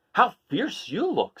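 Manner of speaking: impressed crab. Acted. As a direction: draw it out, impressed. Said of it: "said Hermit Crab".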